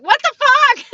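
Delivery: sudden high shocked shout; startled yell. Retold or imitated. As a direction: yelp it like you just got startled. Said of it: "and I was like".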